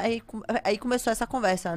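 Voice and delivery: ordinary talk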